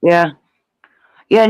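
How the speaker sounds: ordinary talk, nothing marked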